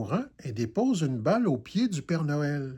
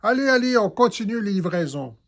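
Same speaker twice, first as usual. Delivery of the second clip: louder, hurrying the elf along; brisk retort; 6 semitones higher, 6 dB louder than usual. A narrator's reading. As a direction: chivvy him on. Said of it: "Le Père Noël réplique".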